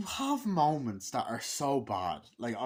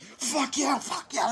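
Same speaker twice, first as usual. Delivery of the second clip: mock gloating shout; 14 semitones higher, 7 dB louder, slower than usual. Bully gloating in retold scene.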